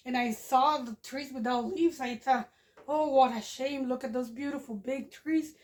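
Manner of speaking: ordinary delivery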